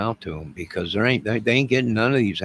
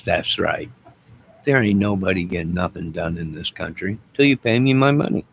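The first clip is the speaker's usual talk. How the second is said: ordinary talk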